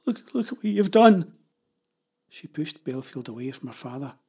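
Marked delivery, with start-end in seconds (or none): 0.1-1.3 high, loud, upset reproach; tearful accusation. A narrator's reading. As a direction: accuse them through mild tears. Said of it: "Jenny began to cry".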